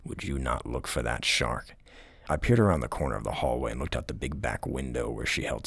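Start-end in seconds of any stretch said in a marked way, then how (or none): none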